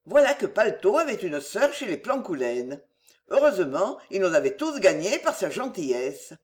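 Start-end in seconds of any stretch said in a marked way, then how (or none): none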